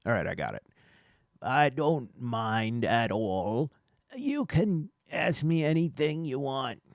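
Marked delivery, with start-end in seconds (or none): 1.4-6.8 slow, drawn-out Oregon man voice; a lightly put-on laid-back Oregon local, slow start; laid-back Oregon local answering an investigator, lightly voiced and slow; Oregon guy voice. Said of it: "I'm gonna get his voice".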